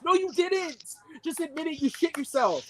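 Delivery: high, exasperated contradiction; heated disbelief, pitch jumping up; mad. Real, not acted. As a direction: push them to confess, indignantly. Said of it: "I'm mad".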